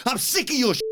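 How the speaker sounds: high, cut-off mock rage; mock angry Pearce. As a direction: snap it high and break off. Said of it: "he says".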